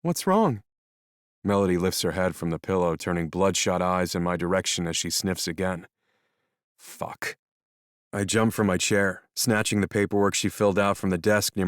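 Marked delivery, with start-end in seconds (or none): 0.0-0.6 put-on higher, anxious young woman; alarmed young woman voice; worried young woman. Melody alarmed. 6.8-7.4 low breathy whispered swear; whispered curse. Acted. Narrator's panicked inner curse.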